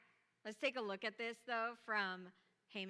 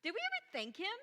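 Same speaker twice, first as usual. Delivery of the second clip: much higher, louder startled realization; surprised king; 10 semitones higher, 7 dB louder than usual. Retold or imitated. The sleepless king hearing of Mordecai, loud surprised. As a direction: gasp out the sudden memory. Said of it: "the king is like".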